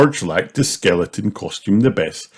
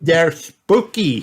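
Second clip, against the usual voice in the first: Peppa voice; 9 semitones higher, slower than usual. A narrator's reading. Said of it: "said Peppa".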